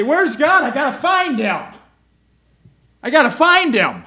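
desperate, loud search for God; urgent seeking. Shown as a demonstration. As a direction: cry out like you must find him.

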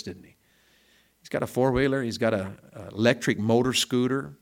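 ordinary delivery

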